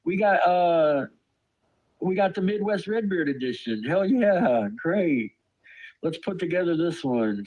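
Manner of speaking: high-pitched, hammed-up delight; mock enthusiasm, pitched well up; mock-excited shopper. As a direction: play a thrilled shopper finding a new puzzle. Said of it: "you go to the store and you".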